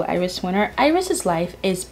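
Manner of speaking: normal talking voice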